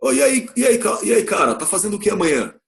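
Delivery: hey-dude slangy question; casual slang. Shown as a demonstration. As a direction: call out to a pal, relaxed. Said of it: "numa gíria".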